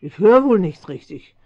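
can't believe what she just heard; indignant surprise. Acted.

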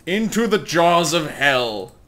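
loud, slow, hammed-up proclamation; mock-dramatic. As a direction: declaim it like a mock-epic battle cry. Mock-epic end to plotting the route.

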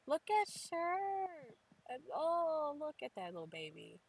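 very high, drawn-out coo; slow, high-pitched fawning; adoring coo. Real, not acted. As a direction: gush over something adorably cute. Cooing over a cute character.

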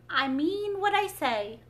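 slow, huffy insistence; offended. A narrator's reading. Offended Mock Turtle answering Alice, slow and huffy. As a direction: take it as a slight. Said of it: "in an offended tone".

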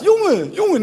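high sputtering "Jongen, jongen" like a stalling car; Moroccan Dutch street. Retold or imitated. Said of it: "net zo Daihatsu ... wil starten".

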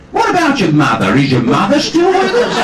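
very high, loud mock New Zealand interviewer; New Zealand accent. Interviewer asks about the guest's mother, imitated New Zealand accent. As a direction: send up a tactless New Zealand interviewer, pitched right up. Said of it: "whether I can do the accent".

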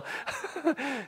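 high, quiet giggle; soft laugh while watching the scene; amused chuckle. Real, not acted. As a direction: chuckle lightly to yourself. Amused watching lawmaker greet everyone.

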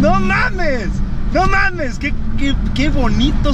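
high, thrilled outburst; excited exclamation. Real, not acted. Vlogger thrilled by the car's sound, high excited shout.

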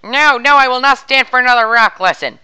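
loud, high protest; shouting protest. Acted. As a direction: cry out in exasperation. Customer refuses another lecture.

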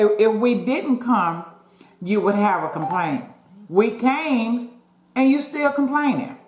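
high exasperated retort; exasperated scolding. Retold or imitated. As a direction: lecture her, rising in pitch. Retold quarrel with mother.

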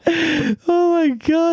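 high, drawn-out wheeze of disbelief; helpless laughter. Real, not acted. Reacting to [name] as Joker, cracking up. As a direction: squeal it slowly through laughter.

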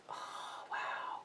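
breathless little whisper of wonder; awed whisper. Real, not acted. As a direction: whisper it in awe. Whispering in awe over bracelet.

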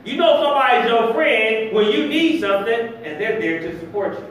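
ordinary delivery

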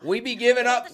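put-on playful copy of her accent; imitating Sasha's dialect. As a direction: echo the character's old way of talking. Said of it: "Why doesn't she talk like this anymore?".

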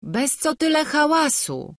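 high-pitched brushing-off; what's-the-fuss complaint; dismissive protest. A narrator's reading. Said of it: "mówili, wzruszając ramionami".

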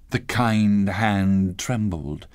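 ordinary delivery

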